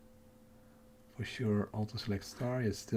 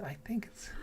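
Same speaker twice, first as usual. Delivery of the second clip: hushed, fading whisper; 9 semitones higher, 10 dB quieter than usual. Real, not acted. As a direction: keep it hushed. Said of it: "sorry for the whispering".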